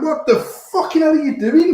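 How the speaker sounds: cracking up in disbelief; laughing disbelief. Retold or imitated. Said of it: "and I was like".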